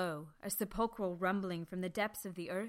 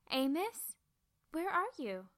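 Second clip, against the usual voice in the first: put-on higher young voice calling across the valley; young Bertie calling, higher; girl calling out; 8 semitones higher than usual. A narrator's reading. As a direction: search for him by voice. Bertie calls searching.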